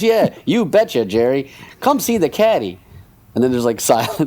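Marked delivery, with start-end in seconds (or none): 0.0-2.8 [name] promo voice. Retold or imitated. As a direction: sell it like an old showbiz straight man. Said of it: "And the guy goes".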